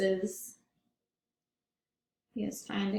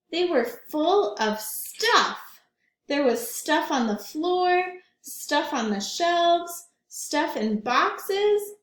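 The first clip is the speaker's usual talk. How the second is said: higher, slow and emphatic, list by list; storytime build-up; 6 semitones higher, 4 dB louder, slower than usual. A narrator's reading. Teacher reads the list of stuff with growing wonder. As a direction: stretch out each pile of stuff for the kids. Reading picture book aloud to class.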